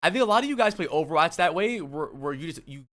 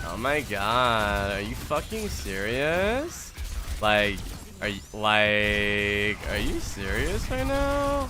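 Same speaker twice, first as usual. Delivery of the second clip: put-on slow, drawn-out Valley girl whine; mock a California Valley girl; Valley girl accent; 5 semitones lower, much slower than usual. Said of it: "The only California accent I know is the Valley".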